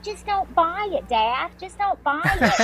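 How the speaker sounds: high, slow pleading quote; slow, pleading daughter's plea; quoting her daughter. Retold or imitated. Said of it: "she's like".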